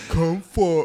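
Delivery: loud, dopey over-pronounced word; exaggerated dim-witted enunciation; mock slow pronunciation. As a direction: mimic a slowed-down recording. Mimicking the slowed pronunciation clip.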